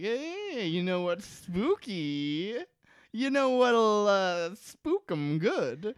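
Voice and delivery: high, drawn-out spooky teasing voice; spooky mock voice. Podcast host mocking the show as a spooky storyteller. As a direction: ham up a spooky narrator.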